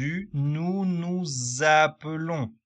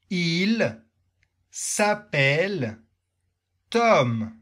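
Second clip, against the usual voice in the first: slow model for repeating; 3 semitones higher, 4 dB louder, slower than usual. Shown as a demonstration. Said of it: "Tu répètes avec moi".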